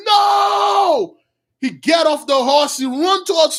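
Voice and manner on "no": long, high, loud cry of horror; anguished scream. Retold or imitated. Father seeing his daughter at the gate, screaming in horror. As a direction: scream it out, drawing the word long. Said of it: "He screamed".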